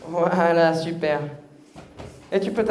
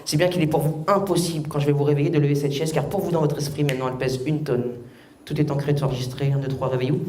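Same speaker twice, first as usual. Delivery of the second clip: hypnotic suggestion; 6 semitones lower than usual. Acted. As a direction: plant the suggestion calmly.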